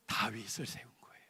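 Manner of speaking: soft hushed whisper; whispered reveal. Acted. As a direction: reveal it in a hush. (속삭이듯 조용히 밝히는 말)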